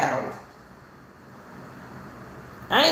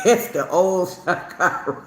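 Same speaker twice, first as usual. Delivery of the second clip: ordinary talk, nothing marked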